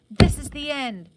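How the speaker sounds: loud booming echo that slows and fades away; echoing through the house. A narrator's reading. Words echo through the abandoned house, booming then fading. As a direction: let the words ring and die off like an echo. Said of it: "the words echoed through the house".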